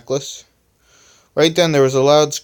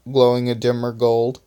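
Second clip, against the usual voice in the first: usual speaking voice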